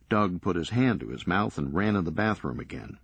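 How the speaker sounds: plain reading voice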